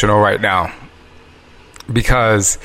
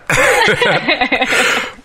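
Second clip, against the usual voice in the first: laughing; 14 semitones higher than usual. Real, not acted. Laughs after her own quote.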